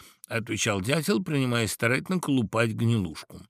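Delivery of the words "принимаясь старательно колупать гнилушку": usual speaking voice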